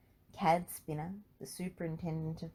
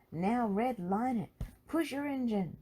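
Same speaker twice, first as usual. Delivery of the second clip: higher, pressing orders over the radio; urgent coaching; 7 semitones higher than usual. A narrator's reading. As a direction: push him hard, urgently. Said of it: "Blade urged him".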